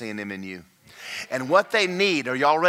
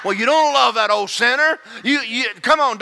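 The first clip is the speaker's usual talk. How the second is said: loud, high, rising sermon shout; full-force preaching; 9 semitones higher, 9 dB louder than usual. Acted. Pastor shouting the sermon at full force. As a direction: preach it to the rafters.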